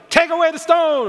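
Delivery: high, loud command; commanding call. Shown as a demonstration. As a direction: bark it out with authority. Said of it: "I wonder if he was like".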